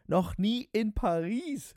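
slow, high, grandiose repeat; boastful. A narrator's reading. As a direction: draw it out with pompous disbelief. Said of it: "wiederholte er großspurig".

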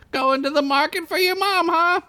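put-on high teasing girl voice; tomboy friend. Squeak teases Derek about his errand, in a high playful voice. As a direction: tease him in a high girlish voice. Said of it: "she said".

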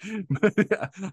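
high, breathy laugh at himself; embarrassed laugh. Real, not acted. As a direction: laugh it off sheepishly. Embarrassed after sarcastic-sounding remark.

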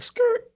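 high-pitched imitated tire screech; sudden high "skrrt"; screech noise. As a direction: screech it like braking tires. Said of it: "and I was like".

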